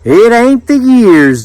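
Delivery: high, slow country woman; country Judith. A narrator's reading. Judith explaining herself to the islander, high slow drawl.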